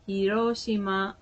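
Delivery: Hiroshima level, no syllable stressed; Japanese pronunciation. Shown as a demonstration. Repeating the correct form, Hiroshima in even Japanese syllables. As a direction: say Hiroshima as four even syllables, hee-ro-shee-ma. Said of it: "You don't put an emphasis".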